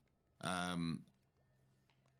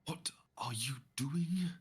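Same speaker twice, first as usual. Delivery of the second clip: sharp whispered rebuke; hissed whisper; 4 semitones higher than usual. Acted. Seville glares at Corgi and whispers sharply. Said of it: "she kind of glares at you".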